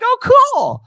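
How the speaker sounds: hammed-up timid guy gushing.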